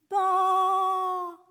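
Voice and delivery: loud, high bleating "baa"; sheep bleat. A narrator's reading. Trapped sheep calling out to its shepherd, a loud bleat. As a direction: bleat like a frightened lost sheep. Lost sheep cries again.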